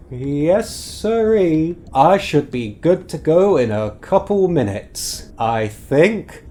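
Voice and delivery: a hint of nervous bluster. Sheriff nervously insisting he'll leave soon, lightly voiced. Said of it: "kind of nervously now".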